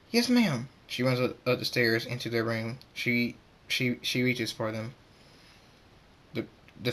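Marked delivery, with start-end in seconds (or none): 0.1-0.7 high, hurried-sounding assent; alarmed reply. Acted. Pink Pie answers Missus Cake during the attack, alarmed high reply. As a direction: answer an urgent order in alarm. Stammered reply to urgent order.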